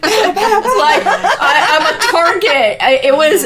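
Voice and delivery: high, laughing through the words. Real, not acted. Guest laughing about being the family target. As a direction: laugh it off while saying it. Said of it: "laughing at herself".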